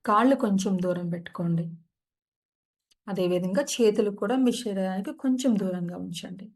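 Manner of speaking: calm, unhurried meditation guide; soothing yoga nidra guidance; guided meditation. Acted. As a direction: ease listeners into stillness.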